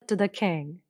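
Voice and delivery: ordinary delivery, nothing marked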